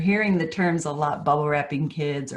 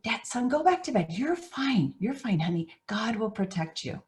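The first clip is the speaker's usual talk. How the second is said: a touch of impatience, higher voice; mildly frustrated dad; 6 semitones higher than usual. Acted. Sleepy father sending his son back to bed, mildly impatient. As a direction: hurry the boy back to bed, lightly exasperated. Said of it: "This dad's getting a little frustrated".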